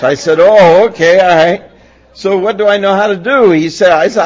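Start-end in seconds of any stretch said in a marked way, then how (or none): 0.4-3.5 put-on loud, high flustered kid; startled boy, loud and high; flustered teen. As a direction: blurt it out like a caught-off-guard fourteen-year-old. Teen reacting to father.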